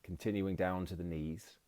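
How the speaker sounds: calm, measured meditation voice with long pauses; guided meditation. Acted. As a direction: guide them gently into relaxation, phrase by phrase. Leads a mindfulness body scan.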